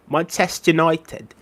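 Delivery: Manchester United slowed down, United said like noitəd; Scouse accent. Shown as a demonstration. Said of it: "that's really how they say it".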